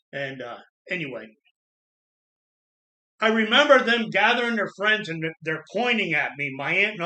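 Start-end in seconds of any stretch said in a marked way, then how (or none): none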